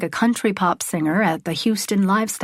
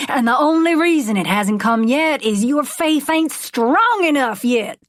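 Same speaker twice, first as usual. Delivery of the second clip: raised, stretched-out preacher voice; revival preaching; 3 semitones higher than usual. Acted. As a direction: preach it to the whole stadium. Preacher sermon to crowd.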